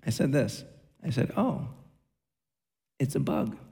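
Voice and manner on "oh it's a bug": softly and calmly, unbothered: Oh, it's a bug; quiet, matter-of-fact mild remark. Retold or imitated. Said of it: "I said it just like that".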